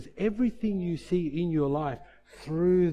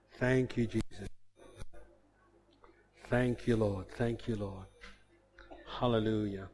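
soft, low murmured prayer in tongues; quiet prayer; 4 semitones lower, 5 dB quieter than usual. Real, not acted. Pastor closes the service in soft murmured prayer. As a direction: pray softly under the breath.

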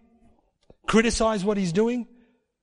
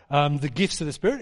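ordinary talk

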